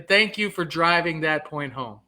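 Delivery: usual speaking voice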